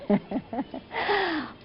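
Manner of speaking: high, soft giggle; high-pitched little chuckle; light laugh. Real, not acted. Laughs off her sore-throat remark.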